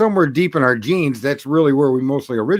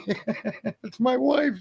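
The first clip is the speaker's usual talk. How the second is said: high, wailing imitation of sobbing; mock crying wail; 10 semitones higher, 4 dB quieter, much slower than usual. Husband recognizing his wife under the sheet, mock wailing cry. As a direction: ham up a grieving husband's cry, pitched way up.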